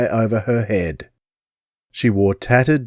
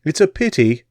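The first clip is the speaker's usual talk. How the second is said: higher English lady, regretful; Englishwoman; 5 semitones higher than usual. Acted. Englishwoman pitying the boys. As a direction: sigh it in English accent. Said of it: "the Englishwoman said".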